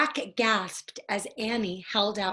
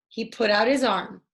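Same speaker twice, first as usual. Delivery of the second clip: ordinary delivery, nothing marked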